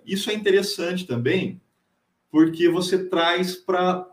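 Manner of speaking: ordinary delivery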